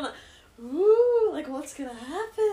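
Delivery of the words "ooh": loud, high eager ooh; excited ooh. Shown as a demonstration. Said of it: "No, I'm just doing".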